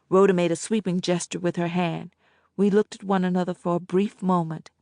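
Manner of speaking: ordinary delivery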